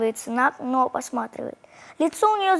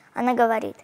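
plain reading voice

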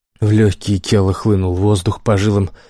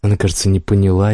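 ordinary delivery

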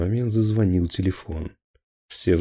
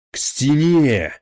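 slow, drawn-out roar, louder and higher; roaring order; 7 semitones higher, 7 dB louder, slower than usual. Acted. Captain roars at officers to face the wall. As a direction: bellow it at the officers. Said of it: "проревел Хонинов".

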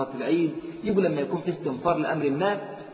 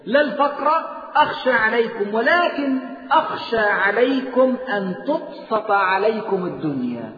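higher, louder and slow, preaching at full force; forceful hadith recitation; 5 semitones higher, 4 dB louder, slower than usual. Acted. Preacher warns the congregation, raised slow forceful recitation. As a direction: drive the warning home with raised voice.

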